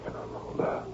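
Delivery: breathless whispered name; hushed in shock; stunned whisper. Acted. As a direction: barely voice it, stunned. Stunned repeat of the names.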